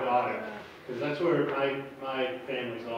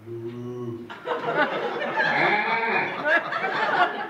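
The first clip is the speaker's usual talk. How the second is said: half-moo, half-bleat animal imitation; goat mooing like a cow; 6 semitones higher, 4 dB louder than usual. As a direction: start as a cow, jump up into a goat scream. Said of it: "He thinks he's a cow".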